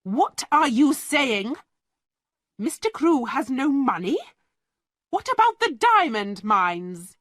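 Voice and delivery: a headmistress who cannot believe her ears; angry disbelief. Acted. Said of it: "She looked at the lawyer angrily".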